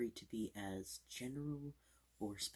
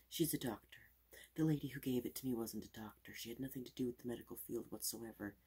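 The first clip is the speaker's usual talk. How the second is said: fast aggressive asmr; 3 semitones higher, 6 dB louder than usual. Acted. Fast loud ASMR showing.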